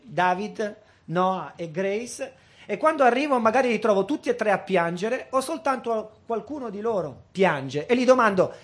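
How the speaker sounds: ordinary talk, nothing marked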